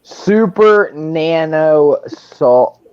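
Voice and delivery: super nano salt tank said very slowly; slow clarifying repeat. Shown as a demonstration. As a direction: spell it out slowly, word by word. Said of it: "Did you say banana salt?".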